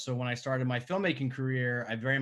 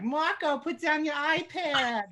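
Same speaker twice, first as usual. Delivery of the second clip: much higher, slow mock New York Italian mom scolding; Italian American mother. Actresses auditioning overplay a New York Italian mother scolding Marco. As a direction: ham up a nagging New York Italian mother calling her kid. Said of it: "the women come in and they're like".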